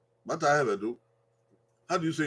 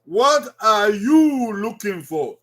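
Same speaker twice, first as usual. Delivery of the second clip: "what are you looking for?" slowly, each word clear; slow teaching model; 4 semitones higher, 4 dB louder, slower than usual. Shown as a demonstration. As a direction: slowly, model the question for learners.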